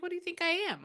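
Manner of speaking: high-pitched mock offence; imitating someone mildly insulted; indignant. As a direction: toss it back as an affronted question. Spirit offended at the question.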